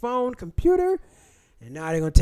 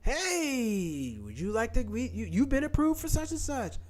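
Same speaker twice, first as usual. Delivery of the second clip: imitated sales message, higher pitched; mock scam voicemail; 6 semitones higher, 5 dB quieter, slower than usual. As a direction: put on a telemarketer's drawn-out greeting. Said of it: "But they call you, leave you messages".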